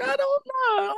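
mock falsetto I don't know, way up high; shrill baffled falsetto; high bewildered squeak. Retold bewildered reaction.

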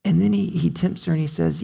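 ordinary talk, nothing marked

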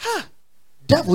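ordinary talk

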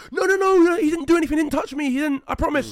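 high frantic protest; high, loud mock screaming plea; pleading screams. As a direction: imitate a desperate girlfriend. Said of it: "Jess is there screaming like".

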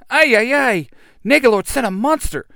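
high, loud panicked alarm; panicked robot. Acted. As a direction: squeal it out in panic. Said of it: "Alpha says".

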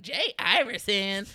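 slow, loud, drawn-out teasing name-call; mocking taunt. Dorm boy teasing a classmate's made-up name, mocking. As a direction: imitate a classmate jeering. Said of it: "start talking shit".

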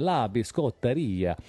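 slow, playful Italian accent; best Italian accent. Shown as a demonstration. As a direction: ham up an Italian accent, drawn out. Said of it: "in your best Italian accent".

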